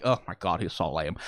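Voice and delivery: put-on eye-rolling teen contempt, low voice; mock a bored, disdainful teenager; Luna. Teenager dismissing her lame boss, low scornful mock voice. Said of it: "Luna is the eye-rolling".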